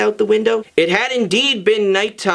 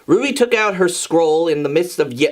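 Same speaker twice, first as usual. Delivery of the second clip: ordinary delivery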